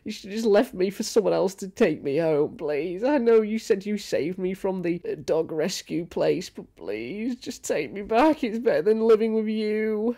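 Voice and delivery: high, pleading dog voice; mock pitiful dog begging its owner. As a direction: beg to go back, high and whiny. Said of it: "They cannot tell us".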